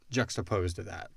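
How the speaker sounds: ordinary talk, nothing marked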